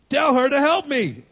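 high, loud and put-upon; exasperated sister's plea; whiny complaint. Retold or imitated. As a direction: make her sound fed up and demanding. Martha complaining to Jesus.

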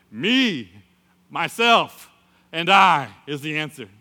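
loud, punched, separate words; self-assertive boast. Retold or imitated. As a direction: announce yourself proudly, one piece at a time. Own spirit steps up boasting.

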